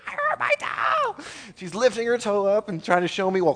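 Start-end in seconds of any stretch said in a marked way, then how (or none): 0.1-1.2 put-on high wailing little-girl voice; crying child. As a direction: wail it like a hurt child. Daughter crying about stubbed toe.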